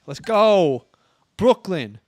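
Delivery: loud, high Brooklyn hype shout; hammed-up New York street cry; New York accent. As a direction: yell it like a die-hard Brooklyn fan. Hamming up New York pride.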